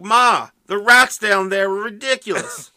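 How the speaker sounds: hammy New York guy; New York accent. Retold or imitated. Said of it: "That's the worst New York accent".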